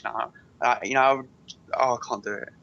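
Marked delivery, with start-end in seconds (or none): none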